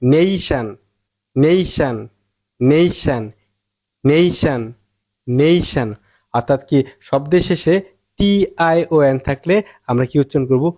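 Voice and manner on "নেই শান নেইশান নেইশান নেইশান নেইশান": নেইশান with long "nei", falling onto "shan"; stressed first syllable. Shown as a demonstration. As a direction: drill নেইশান, punching "nei". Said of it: "আগেরটা জোর দিয়ে". (নেইশান, "নেই"-তে জোর)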